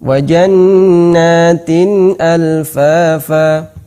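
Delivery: Wa jannatin alfafa slow, nun in tin clear; clear tanwin. Shown as a demonstration. Teacher reciting clear tanwin before hamzah. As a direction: chant Wa jannatin alfafa without lengthening. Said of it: "jelas nunnya".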